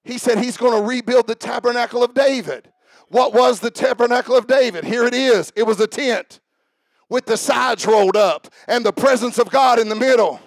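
full-force preaching, high and loud. Real, not acted. Preacher to congregation on worship, building to a shout.

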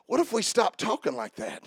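normal talking voice

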